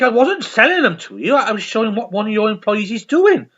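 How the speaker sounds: loud, high, indignant correction; exasperated Father Ted. Retold or imitated. Father Ted correcting the manager, loud and indignant. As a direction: set him straight, offended. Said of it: "And he's like".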